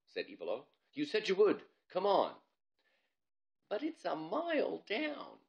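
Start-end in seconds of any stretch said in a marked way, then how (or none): 0.9-2.3 put-on higher, pushy young girl; bossy girl daring her friend; urging girl voice. Ivalo pressing Bob. 3.7-5.4 much higher, slow, fearful; scared boy whine. Acted. Bob scared on the climber.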